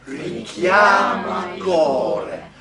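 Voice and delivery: loud, very slow "richiama il cuore", weight on CHIA and CO; stress on CHIA, CO. Shown as a demonstration. Coach leading the whole line for singers, stress on CHIA and CO. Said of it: "The accents are on chia and co".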